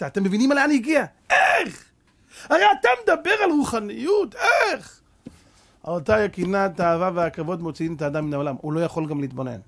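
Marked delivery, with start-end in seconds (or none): 1.3-5.0 high-pitched, exclaimed "how?!"; soaring, exasperated protest; incredulous outcry. Acted. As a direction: cry out in indignant disbelief. Acted outraged disbelief at Korach.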